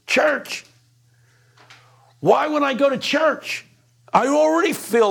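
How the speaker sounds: high, loud, scornful yell; yelling back. Retold or imitated. Prostitute yelling back at naive Phil, loud scornful outburst. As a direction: throw the word church back at him, loud. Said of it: "And she yells back at him".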